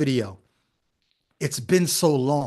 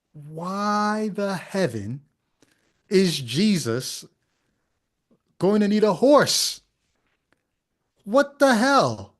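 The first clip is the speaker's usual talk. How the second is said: slow, drawn-out incredulous question; exasperated disbelief; 2 semitones higher, slower than usual. Real, not acted. Host baffled that Jesus needs a horse, incredulous. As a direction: stretch each phrase, building in pitch to the end.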